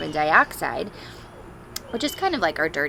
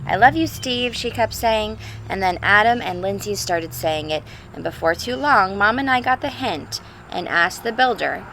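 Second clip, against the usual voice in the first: put-on clear, louder reading-to-children voice; storybook read-aloud; 3 semitones higher, 6 dB louder than usual. Teacher reading a picture book to the class, storybook voice. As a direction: read it like a father's letter to his kids.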